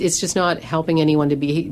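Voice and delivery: ordinary talk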